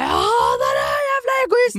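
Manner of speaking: hysterical high-pitched shrieking; imitated screaming. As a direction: mimic a furious woman screaming at full pitch. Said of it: "skrika och så". (hysteriskt, gällt härmat skrik)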